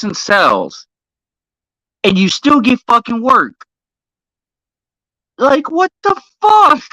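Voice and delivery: incredulous fury that keeps rising in pitch; exasperated rant. Real, not acted. Streamer ranting to chat about a comic writer, exasperated rising rant. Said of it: "trying to get my composure".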